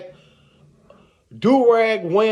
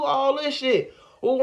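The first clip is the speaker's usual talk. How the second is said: ordinary talk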